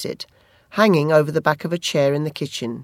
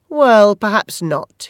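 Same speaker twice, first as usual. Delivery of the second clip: slow, louder, smug bully; slow mock bully; 4 semitones higher, 6 dB louder, slower than usual. Barry Cowan pretending to reconsider, slow and smug. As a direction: draw it out with false thought before the cruelty.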